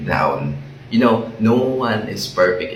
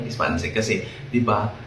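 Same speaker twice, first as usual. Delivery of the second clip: ordinary delivery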